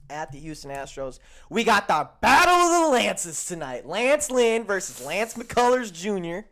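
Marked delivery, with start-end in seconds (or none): 1.5-6.4 loud, high, stretched-out billing of a big showdown; hyped race announcer. Acted. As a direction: boom the names like a race announcer. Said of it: "I have to say it like that".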